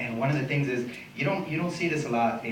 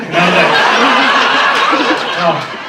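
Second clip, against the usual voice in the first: laughing through it; 3 semitones higher, 9 dB louder, slower than usual. Real, not acted. Comic cracking up mid-story.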